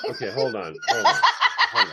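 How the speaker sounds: laughing while stopping her. Real, not acted. Laughing at the cohost's absurd order, laughing hold on.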